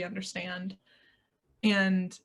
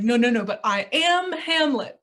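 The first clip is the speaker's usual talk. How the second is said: insistent protest; 4 semitones higher, 6 dB louder than usual. Pretend. Arguing with his inner voice.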